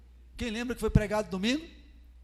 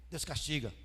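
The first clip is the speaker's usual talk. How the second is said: normal talking voice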